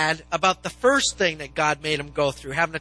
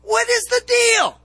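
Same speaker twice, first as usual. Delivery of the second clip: much higher, louder exasperated protest at God; exasperated complaint; 11 semitones higher, 5 dB louder than usual. Pretend. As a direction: play a fed-up Joseph throwing his hands up. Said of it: "if I was Joseph, I'd be like".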